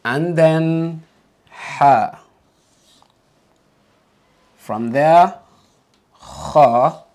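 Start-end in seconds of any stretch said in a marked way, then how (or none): 1.5-2.2 ha, air pushed from the throat; Arabic ha. Shown as a demonstration. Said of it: "Now say that after me". 6.2-7.0 kha: rasping ch sound from the back of the throat; kha like clearing your throat; Arabic kha. Shown as a demonstration. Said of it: "the last letter we're gonna learn today".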